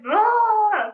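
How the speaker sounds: loud, high burst of giddy excitement; excited outburst. Pretend. Bursting with excitement about the training, a giddy yell. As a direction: blurt it out like you can't contain yourself. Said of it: "I'm just like".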